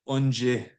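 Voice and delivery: Onje said slowly and carefully, on-jeh; pronunciation demo. Shown as a demonstration. Teacher models the word Onje. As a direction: sound out Onje for the learners. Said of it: "relative root on the front".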